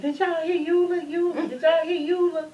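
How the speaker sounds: high, awed exclaiming with a tremble; amazed exclaiming. Retold or imitated. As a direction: cry out in wonder. Mourners amazed at funeral singing.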